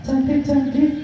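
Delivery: high puppet voice, cheeky; monkey puppet voice. Acted. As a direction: answer like a cheeky little monkey.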